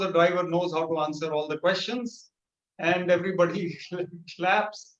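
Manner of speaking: usual speaking voice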